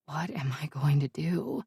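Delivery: breathless unvoiced whisper. A narrator's reading. To herself in panic, whispered. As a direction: barely voice the panic. Narrator's panicked inner question.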